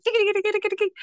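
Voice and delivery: rapid, very high-pitched dinging; imitated bike bell. Friend recalling the bike ride, imitated frantic dinging. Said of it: "You started dinging".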